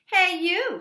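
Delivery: loud high call; loud grouchy hey you; grouchy challenge. Acted. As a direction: pick a fight.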